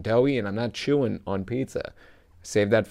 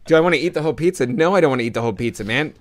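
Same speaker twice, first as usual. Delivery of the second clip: fast, louder incredulous echo; retold indignant retort; 3 semitones higher, 4 dB louder, faster than usual. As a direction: throw the question back, higher and quicker. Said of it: "I was just like".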